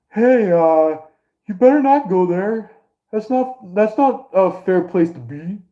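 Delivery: high-pitched mock objection; hammed-up warning off; weird put-on voice. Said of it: "weird ass voice when saying that".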